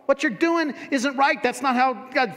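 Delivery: high, loud mocking voice of the critics; hammed-up indignant critic; critical church people. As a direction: scold like an outraged church lady. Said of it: "They're like".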